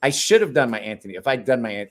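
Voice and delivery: normal talking voice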